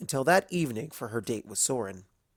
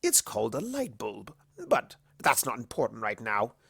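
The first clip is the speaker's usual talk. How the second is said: dismissive whisper; 3 dB quieter than usual. A narrator's reading. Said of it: "Discord dismissed".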